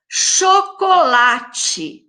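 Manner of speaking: syllable-stretched. Shown as a demonstration. Teacher counting syllables for pupils, "Chocolate" stretched out slowly. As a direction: draw out every syllable of "Chocolate". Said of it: "Chocolate também tem quatro sílabas".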